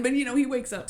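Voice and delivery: normal talking voice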